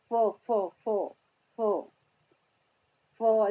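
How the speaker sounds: isolated "फो" repeated with gaps; F sound drill. Shown as a demonstration. Teacher answering how F is read, repeated "फो". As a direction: repeat "फो" as separate syllables. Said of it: "क्या पढ़ना है?".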